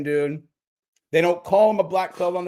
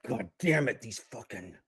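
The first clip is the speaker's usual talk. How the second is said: frustrated; 6 semitones lower, 9 dB quieter than usual. Acted. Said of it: "he's all frustrated".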